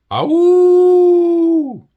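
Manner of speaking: very high, very loud drawn-out howl; long loud howl. Acted. A creature howling right near Owl, long and loud. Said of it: "much closer".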